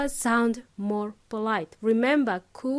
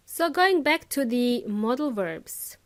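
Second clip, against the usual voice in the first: usual speaking voice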